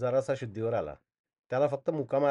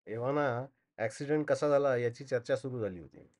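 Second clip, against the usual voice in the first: usual speaking voice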